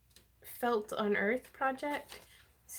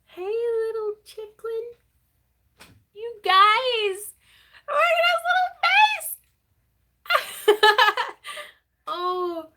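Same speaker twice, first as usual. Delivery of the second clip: squealing high over a cute chick; overwhelmed by cuteness; 12 semitones higher, 9 dB louder than usual. Real, not acted. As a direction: gush in a high, loud squeal. Gushing over felted chick.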